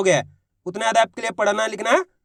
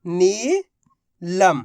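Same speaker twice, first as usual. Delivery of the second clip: very slow, stretched syllables; drawn-out word; 3 semitones lower, much slower than usual. Shown as a demonstration. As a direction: draw the word out so each letter is heard. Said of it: "तो मिलके बन गया अपने". (शब्द को धीरे-धीरे खींचकर बोलना)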